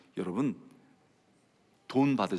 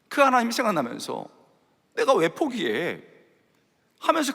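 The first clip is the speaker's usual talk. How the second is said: full-force preaching; 4 semitones higher than usual. Acted. Pastor lifts the congregation with a fervent preaching voice. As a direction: preach this with rising fervor.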